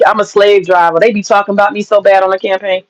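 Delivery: ordinary talk, nothing marked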